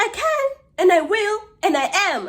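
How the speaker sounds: high, defiant mock yell, easing off; retold shouting. Neighbour answering "don't shout at me", defiant mock shouting. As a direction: imitate a neighbour insisting point by point. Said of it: "You're not going to shout at me".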